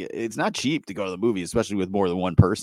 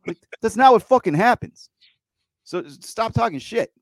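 raised, higher heated rant; worked up; 12 semitones higher, 6 dB louder than usual. Real, not acted. Host ranting at critics who talk shit, heated and higher. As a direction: let the annoyance boil over. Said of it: "I'll just get a little worked up".

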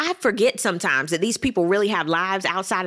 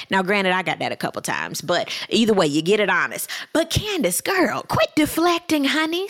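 ordinary delivery

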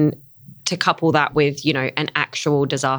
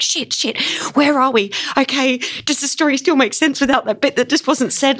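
a pitched-up, flustered imitation of a panicking lighting op; hammed-up backstage panic, muttered curses then a rising question; mock panic; 8 semitones higher than usual. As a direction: play the lighting op scrambling through cues in mild mock alarm. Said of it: "you could just feel this sense of panic".

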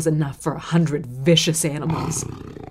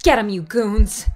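urgent calling out; 6 semitones higher than usual. Acted. Onlooker begs the mob to save the father, crying out. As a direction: pitch climbing into a pleading cry. Bystander urging rescue from the fire.

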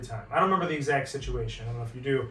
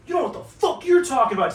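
high, pushed-up comeback; heated retort; 11 semitones higher, 4 dB louder than usual. Retold or imitated. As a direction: fire back in his face. Retold shouting match with PR guy.

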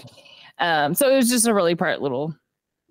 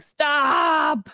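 retold stop; 6 semitones higher, 3 dB louder than usual. Dad cuts off son's speech.

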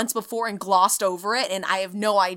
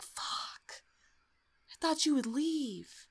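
disappointed ghost; 5 semitones higher, 10 dB quieter, slower than usual. Retold or imitated. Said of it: "And the ghost is like".

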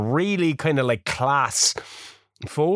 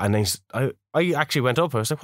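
ordinary delivery

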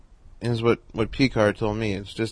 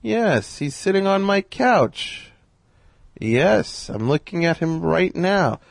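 imitating Carl Reiner; 8 semitones higher, much slower than usual. Carl on the phone, turning.

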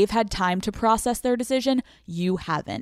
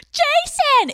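a much higher, louder hammed-up wail; overdone heartbroken yelp of his name; mock wailing cry of a name; 4 dB louder than usual. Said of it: "It's not you being like".

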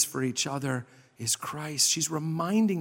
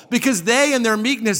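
high, emphatic sermon delivery; impassioned preaching; 8 semitones higher, 4 dB louder than usual. Real, not acted. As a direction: raise the pitch and drive the point home. Preaches at full force.

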